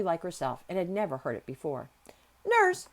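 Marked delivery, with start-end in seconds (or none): 2.4-2.9 high, loud summons; commanding call. A narrator's reading. Girl summoning the nurse, commanding call. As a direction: call out sharply for instant obedience. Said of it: "she commanded".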